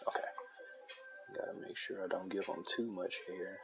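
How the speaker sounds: ordinary delivery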